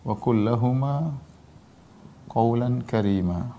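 slow, measured Arabic recitation with pauses; teacherly scripture recitation, drawn out; word-by-word Quran recitation. Acted. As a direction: recite the verse slowly, phrase by phrase.

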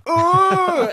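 loud, high hammed-up ooh; bug-eyed cartoon yelp. Mocking Mitch's eyes popping at the bill, loud high ooh. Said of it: "as though he imagined he was a cartoon dog".

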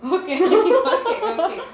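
high laughing okays. Real, not acted. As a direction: burst out laughing mid-word. Laughing fit.

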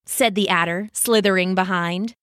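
ordinary delivery

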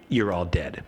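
low, deadpan pronouncement; lightly voiced ominous game master; game master's verdict. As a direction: hand down doom in a low voice.

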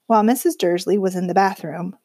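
ordinary delivery, nothing marked

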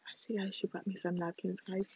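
quiet behind-the-hand aside; hushed aside. Real, not acted. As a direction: keep it low so Nana doesn't hear. Hushed aside while Nana is near.

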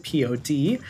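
letters P O D named one by one; spelling it out. Shown as a demonstration. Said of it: "at Your Next Pod".

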